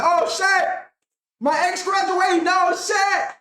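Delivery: high-pitched hammed-up celebration; mock cheering. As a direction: pretend to jump up cheering for your ex. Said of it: "jumping up like".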